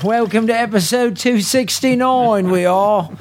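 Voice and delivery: hammed-up bad Australian accent, pitched high; a deliberately awful Aussie accent; host opens the show in a mock Australian accent; horrible Australian accent. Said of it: "so I thought I'd do a horrible accent".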